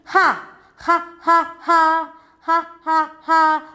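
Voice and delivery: Ha, ha, ha as the bare H sound; H sound repeated. Shown as a demonstration. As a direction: chant the H sound for the class. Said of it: "Come on, tell me".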